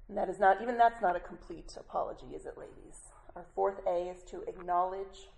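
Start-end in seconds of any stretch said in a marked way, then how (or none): none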